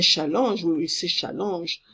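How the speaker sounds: "challenge" said as French "sha-lanzh", nasal vowel; French pronunciation. Shown as a demonstration. Teacher gives the French version, French pronunciation. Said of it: "we have that in French".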